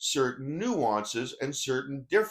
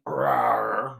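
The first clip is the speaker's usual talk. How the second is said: playful drawn-out "rawr"; a mock carnivore's roar; bear growl; 3 semitones lower, 3 dB louder than usual. Speaker imitating a bear for the audience, playful growl.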